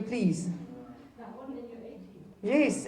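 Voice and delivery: usual speaking voice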